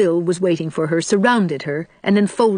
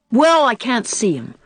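objecting; 5 semitones higher than usual. A narrator's reading. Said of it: "objected Davy".

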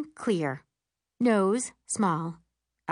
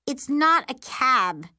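snarling; 5 semitones higher, 5 dB louder, slower than usual. Acted. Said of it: "Miri snarls".

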